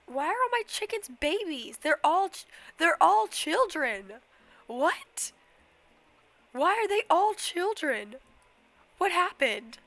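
loud, high, disbelieving shout at the chickens; shocked outcry. Real, not acted. Baby chickens shock her.

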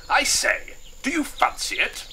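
very high, loud colonel; jovial colonel. Acted. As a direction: answer like a delighted colonel. Colonel invites his friend along.

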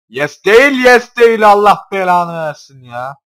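high, loud, slowing into a curse; frustrated yell. Real, not acted. Gamer cursing a missed catch, frustrated yell. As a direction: shout it out in frustration.

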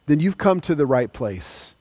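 ordinary delivery

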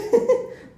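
high breathless giggle; ticklish giggle. Real, not acted. Tickled during massage. (cekikikan geli)

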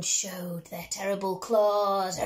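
usual speaking voice

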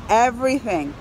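normal talking voice

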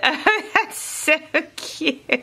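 a high, slow giggle through the words; giggling. Real, not acted. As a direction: laugh your way through it. Giggling over cute tags.

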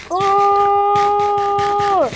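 surprised shout. Real, not acted. Reacting to zombie figure.

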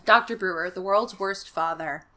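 normal talking voice

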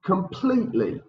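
Completely with PLETE pitched high; stress on PLETE. Shown as a demonstration. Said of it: "Repeat that, please".

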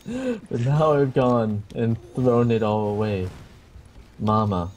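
normal talking voice

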